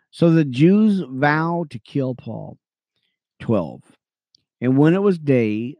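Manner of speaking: earnest Bible reading, lifting on names; steady scripture read. Acted. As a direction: read it like a preacher to his radio friends. Reads scripture slowly.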